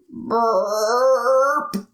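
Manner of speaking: a big cartoon burp sound; mock burp. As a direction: belch it out for the kids. Dragon burps in story.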